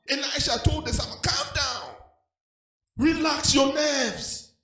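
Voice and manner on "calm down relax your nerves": drawn-out reassuring calm down; soothing calm down. Retold or imitated. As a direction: stretch it out to settle him. Elisha soothing servant again.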